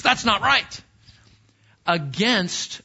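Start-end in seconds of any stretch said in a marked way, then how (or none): 0.0-0.8 higher, louder protest, rising on That's; indignant protest. Retold or imitated. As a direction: cry out against the wrong. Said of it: "It's, it's".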